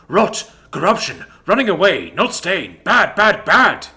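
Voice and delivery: loud, high panicked yelps; panicked alarm. A narrator's reading. Frightened pet warning Daddy to flee, loud panic. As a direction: shout the warning in a frenzy.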